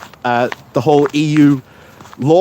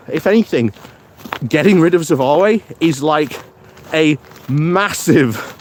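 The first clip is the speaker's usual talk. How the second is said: ordinary talk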